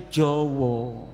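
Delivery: Jawa said as "Jowo", low and rounded; Javanese pronunciation. Shown as a demonstration. Speaker showing his funny Javanese, Jawa as Jowo. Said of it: "Tapi Jawanya lucu".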